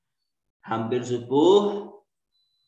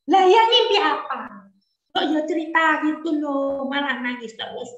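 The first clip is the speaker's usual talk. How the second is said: put-on high, curious child's voice; a boy pestering his father with questions; 12 semitones higher, 5 dB louder than usual. Young Ismail asking his father about the dream in a high child voice.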